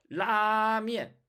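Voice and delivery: 라면 slow, high and loud with a held L; drawn-out Chinese lāmiàn for 라면; Chinese L start. Shown as a demonstration. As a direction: press into the L of 라면. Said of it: "다 L로 시작했습니다".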